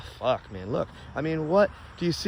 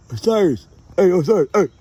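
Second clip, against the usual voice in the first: raised, loud call by name; calling the dog; 4 semitones higher, 7 dB louder than usual. Real, not acted. Owner summoning dog Osiris, loud higher-pitched call.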